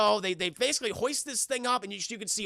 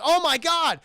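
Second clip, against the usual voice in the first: loud, high shocked exclamation; hammed-up aghast cry; mock horror; 7 semitones higher, 7 dB louder than usual. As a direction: act out horrified discovery. Eddie seeing the destruction.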